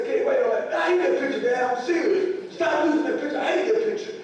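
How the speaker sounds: high, loud, whiny protest with a laugh in it; a mock-outraged kid demanding his rights; indignant teen son. Son complains to mom about his photo, high whiny mock outrage. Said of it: "I said".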